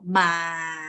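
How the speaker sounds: "ma" held low and level; long, steady low "ma"; straight low tone. Shown as a demonstration. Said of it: "which is straight".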